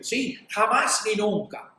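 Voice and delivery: mock-bitter refusal; imitated anger. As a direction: imitate an embittered old woman's emphatic vow. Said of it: "con una ira".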